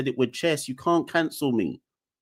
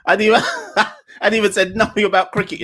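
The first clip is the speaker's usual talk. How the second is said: higher, louder, fast talk through laughter; laughing while talking; 7 semitones higher, 6 dB louder, faster than usual. Real, not acted. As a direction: laugh out the words in disbelief. Laughing at complaints.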